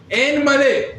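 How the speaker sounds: imitating converts who leave the H off "En Maleh"; dropped H. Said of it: "so they would say".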